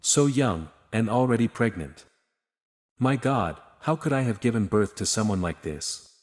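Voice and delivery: usual speaking voice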